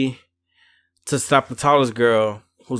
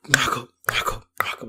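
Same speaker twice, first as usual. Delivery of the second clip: whispered punch sound effects; voiceless, percussive swinging noises; imitated punch noises; 3 dB quieter than usual. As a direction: mimic blows landing in a hard whisper.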